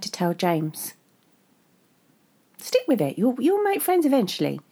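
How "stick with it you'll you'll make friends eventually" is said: condescending. A narrator's reading.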